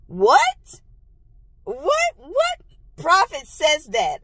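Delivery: high, rising what; baffled outrage; slowed-down incredulous question; stunned disbelief. Real, not acted. Reacting to prophet's remark.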